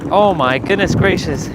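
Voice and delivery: loud exasperated cry; exasperated exclaim. Real, not acted. Struggling with bins in cold.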